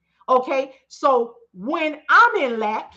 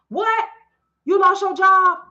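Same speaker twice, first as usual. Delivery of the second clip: high, loud mock gasp of disbelief; playing the shocked helper who gives it all away; 7 semitones higher, 6 dB louder than usual. Mocking herself, hamming up shock at a follower's news.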